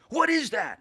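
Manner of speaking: high, loud outraged demand; yelling professor. Retold or imitated. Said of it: "He starts yelling".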